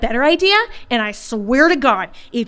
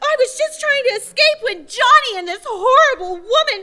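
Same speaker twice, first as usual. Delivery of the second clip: fake tearful relief; 11 semitones higher than usual. Daughter caught, feigning tears to her father. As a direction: play the innocent victim in tears.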